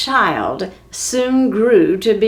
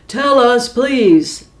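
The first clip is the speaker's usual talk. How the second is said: loud, gruff demand with please tacked on; demanding giant; 4 semitones higher, 5 dB louder, slower than usual. Acted. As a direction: bark it, barely polite. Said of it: "trying to be polite".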